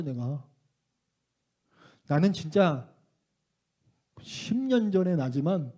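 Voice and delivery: normal talking voice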